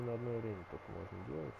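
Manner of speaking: ordinary talk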